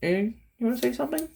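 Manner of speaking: high, fast question to the cat; quick high pet talk. Real, not acted. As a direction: chirp at the cat.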